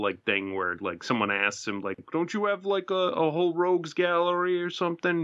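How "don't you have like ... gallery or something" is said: mock questioner. Imitating an interviewer's question.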